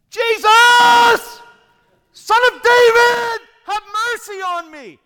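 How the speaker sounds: desperate full-force scream, high and drawn out; huge, slow, pleading bellow; yelling so loud. Acted. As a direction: cry out above a whole crowd. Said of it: "He yelled so loud".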